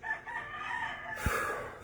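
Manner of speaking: long breathy sigh of relief; overwhelmed sigh. Retold or imitated. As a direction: let out a big emotional sigh. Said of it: "I'm like".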